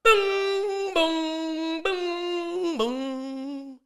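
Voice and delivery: high, sung doorbell tones; imitated door chime. Comedian mimicking the ring at his door, sung chime. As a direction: ring out long, loud held notes. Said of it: "That's my ring".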